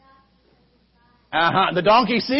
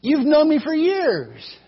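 a hurt donkey reasoning gently; talking donkey; 7 semitones higher than usual. Acted. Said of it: "The donkey says".

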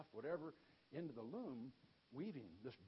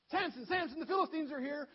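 high, very loud warning cry; frantic high calling out; shouted alarm; 14 semitones higher, 14 dB louder than usual. Acted. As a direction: yell a hammed-up alarm. Delilah raises false alarm again.

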